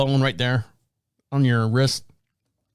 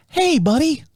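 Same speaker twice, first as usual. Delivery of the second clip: higher, perky nurse greeting; chirpy older nurse; 11 semitones higher, slower than usual. Retold or imitated. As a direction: greet him like a friendly nurse. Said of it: "because she goes".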